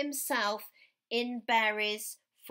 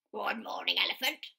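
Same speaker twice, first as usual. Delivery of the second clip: another animal's voice; 3 semitones lower, much faster than usual. A narrator's reading. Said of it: "And they said".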